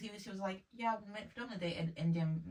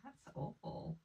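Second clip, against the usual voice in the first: softer, appalled and almost breathless; hushed dismay; 4 semitones higher, 10 dB quieter, slower than usual. Real, not acted. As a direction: let it drop in quiet disbelief. Shocked reaction to dating show.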